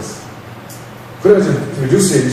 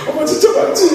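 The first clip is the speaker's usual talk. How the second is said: put-on much higher, laughing little-boy plea; pleading child; 14 semitones higher than usual. Boy begging his mother to confirm, high pleading. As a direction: beg like a teary kid half laughing.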